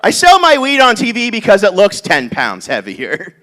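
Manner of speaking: normal talking voice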